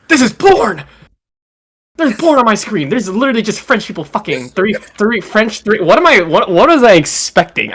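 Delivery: high, loud, rushed disbelief; shocked outburst. Real, not acted. Friend finds porn after searching up French, shocked outburst. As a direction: cry out in alarm, tripping over the words.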